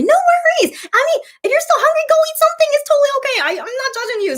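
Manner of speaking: very high, sing-song reassurance; high reassuring. Real, not acted. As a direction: reassure a friend brightly. Reassuring a hungry viewer.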